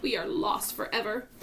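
high-pitched despair; despairing cry. A narrator's reading. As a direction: cry out hopelessly. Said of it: "shouted the Ladybug".

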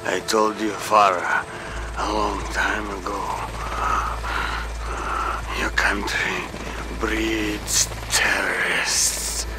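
low, soft, breaking into whisper; weak dying words. Acted. Dying officer to his former captive, weak low voice. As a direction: labour the words out with long pauses.

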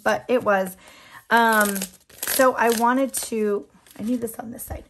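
usual speaking voice

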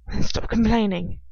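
loud, exasperated retort. A narrator's reading. He tells her off after her protest, exasperated. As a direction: push it out, fed up with the complaints. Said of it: "in exasperation".

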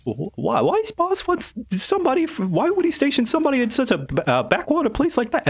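high-pitched, baffled henchman; Julius. Acted. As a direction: question the boss's orders, stammering. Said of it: "Julius is like".

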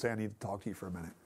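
hushed and hurried, like waking someone gently; soft nudging voice. Retold or imitated. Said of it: "He comes to you in a whisper".

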